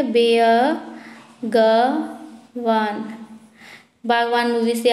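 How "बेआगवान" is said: stretched out slowly, word by word; teacher dictating the spelling to students, slowly; very slow dictation. Shown as a demonstration. Draw out every sound. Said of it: "बेआगवान लिखिए".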